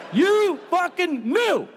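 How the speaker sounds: high, drawn-out furious accusation. Pretend. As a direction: stretch it out, hammed-up outrage. Accusing fan quote.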